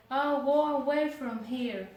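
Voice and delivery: loud, sent through the door; go away shout. Retold or imitated. Girl yelling at her uncle through the door, loud.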